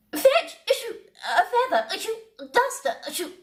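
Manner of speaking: high voice broken by sneezes; sneezing while speaking. A narrator's reading. Queen in the pepper cloud, sneezing through her order. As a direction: get the words out between sneezes.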